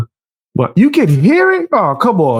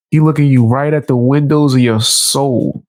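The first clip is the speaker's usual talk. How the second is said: normal talking voice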